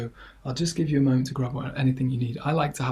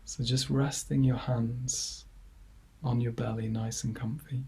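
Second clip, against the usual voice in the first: guided meditation; 5 dB quieter than usual. Acted. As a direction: ease listeners gently into calm, leaving long silences between phrases. Leads a body scan.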